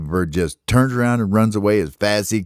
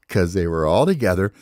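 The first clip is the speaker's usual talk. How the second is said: plain reading voice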